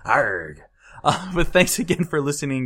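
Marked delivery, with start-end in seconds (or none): 0.0-0.6 pirate arr, a bit louder. Shown as a demonstration. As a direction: answer like a pirate. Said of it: "say it like a pirate".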